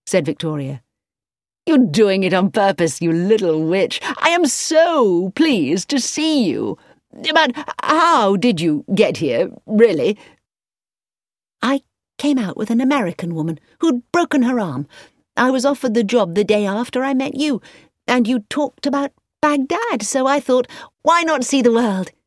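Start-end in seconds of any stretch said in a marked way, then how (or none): 1.7-10.2 put-on high, slow, delighted young man; a hint of a charmed suitor; lightly voiced young man. A narrator's reading. Narrator voices Edward delighted. 11.6-22.1 put-on high, lively young woman telling her tale; proud of her adventure; a hint of a plucky heroine; lightly voiced young woman. A narrator's reading. Narrator voices Victoria.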